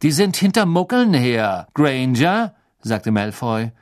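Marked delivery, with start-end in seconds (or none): 0.0-2.6 higher, mocking schoolboy drawl; sneering rich boy. A narrator's reading. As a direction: look down on them and enjoy it. Malfoy taunting.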